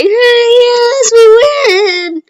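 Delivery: tearful joy. Retold or imitated. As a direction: cheer through happy tears. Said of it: "Bakugo is, like, crying".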